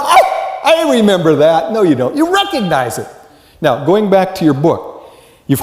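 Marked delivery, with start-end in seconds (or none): 0.0-1.7 high, loud surprised oh; mock recognition. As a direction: act out the false flash of memory. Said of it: "you go".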